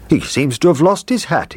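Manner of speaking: high-pitched, animated cook; lively and well above narration; cook character. A narrator's reading. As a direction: voice the cook remarking on a little find. Cook examining the toy soldier.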